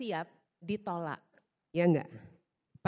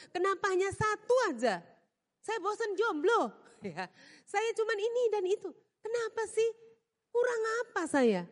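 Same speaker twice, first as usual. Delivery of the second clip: higher, petulant whine at God; whiny complaining believer; 10 semitones higher than usual. Retold or imitated. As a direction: sulk and protest, feeling cheated. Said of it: "berpikir begitu kepada Tuhan".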